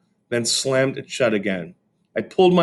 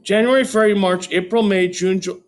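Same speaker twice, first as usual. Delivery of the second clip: high, louder rote recitation; recited; 10 semitones higher, 4 dB louder than usual. A narrator's reading. Fudge showing off to his parents, high recitation. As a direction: reel off the months proudly. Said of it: "Fudge recited".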